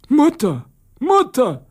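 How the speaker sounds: plain reading voice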